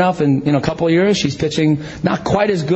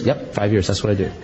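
matter-of-fact answer; 5 semitones lower, 4 dB quieter than usual. Retold or imitated. Voices the leader's matter-of-fact answer.

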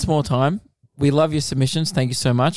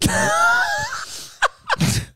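shrieking laugh. Real, not acted. Huge laugh after joke.

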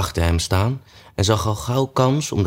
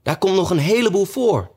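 much higher, hurried rabbit voice; hasty rabbit; 12 semitones higher than usual. A narrator's reading. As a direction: cut in quickly, high and fussy. Rabbit keeps talking to king.

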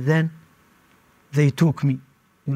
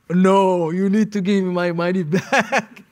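a half-joking pretend demand; laughing at the absurd reply; mock retort; 3 semitones higher than usual.

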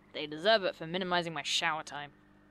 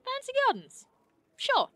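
very high, loud put-on fancy voice; mock posh voice; 6 dB louder than usual. Mocking the fancy gardens suggestion in a high posh voice. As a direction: ham up a snooty lady.

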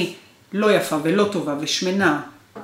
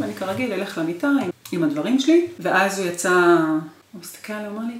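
ordinary talk, nothing marked

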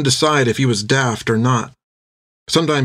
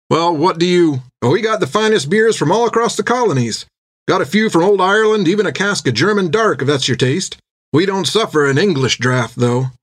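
higher-pitched waiter with a lively sales pitch; tavern waiter; 6 semitones higher than usual. Acted. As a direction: reel off the beers like a busy barman. Narrator plays the tavern waiter.

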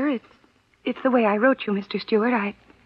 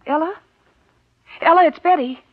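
raised voice calling through a door; 6 semitones higher, 3 dB louder than usual. Acted. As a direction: call to her sister through the door.

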